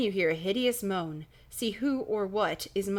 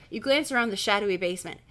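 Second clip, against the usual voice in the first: plain reading voice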